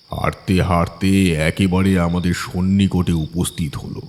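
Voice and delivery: usual speaking voice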